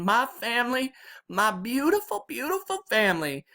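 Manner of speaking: high, tearful Southern granny voice; weeping Southern grandma. A narrator's reading. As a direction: gush tearfully in a Southern accent. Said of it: "except in that Southern style accent, and all the weeping".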